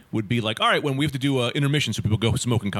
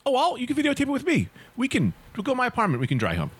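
put-on higher, quick eager young woman voice; eager neighbour girl; 11 semitones higher than usual. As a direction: play the neighbour girl, higher, rushing her offer.

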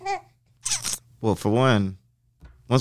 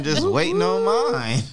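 high, slow whiny longing; mock pining; slower than usual. Man joking he wants a ring too, high slow whine. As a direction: whine it like a kid waiting.

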